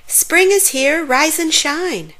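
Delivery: high, lilting wake-up call; singsong call. A narrator's reading. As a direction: call it out high and cheery, almost singing. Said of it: "sang to its neighbor".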